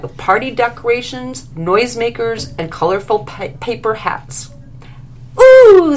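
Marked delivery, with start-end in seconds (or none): 5.4-6.0 much higher, much louder squeal of delight. A narrator's reading. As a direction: squeal like a thrilled child. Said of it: "squealed Lily".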